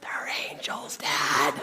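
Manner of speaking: put-on hushed child's stage whisper; awed whisper. Son telling Dad who rescuers are, awed whisper. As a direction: whisper it like a secret revelation.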